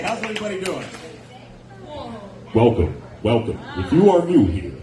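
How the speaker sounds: ordinary talk, nothing marked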